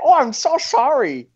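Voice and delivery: very high-pitched mock apology; squeaky caricature of an apologetic manager; Mickey Rooney caricature. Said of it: "Mickey Rooney comes out".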